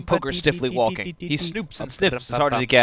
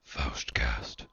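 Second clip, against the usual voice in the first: breathy whisper of the title; 14 semitones lower, 12 dB quieter than usual. Acted. Narrator whispering the play's title. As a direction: whisper the name like a secret.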